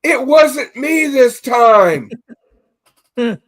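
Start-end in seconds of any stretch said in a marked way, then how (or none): 0.0-2.1 high, loud and slow, drawn out; mock guilty man pleading innocence; sheepish denial. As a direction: drag out a guilty excuse. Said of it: "And the guy goes".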